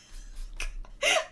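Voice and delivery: high, airy giggle fit; breathless high laugh; helpless laughter. Real, not acted. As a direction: crack up helplessly. Cracks up attempting the accent.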